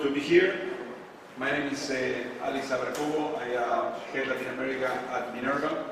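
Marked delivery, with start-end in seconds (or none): none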